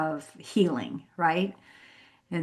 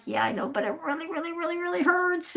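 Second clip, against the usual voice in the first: pained whimper; 10 semitones higher, 5 dB quieter, faster than usual. Retold or imitated. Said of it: "And I'm like".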